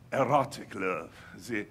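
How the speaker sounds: put-on low, amorous French lover accent; low Frenchman savouring romance; mock French accent. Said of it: "I'm always French when I".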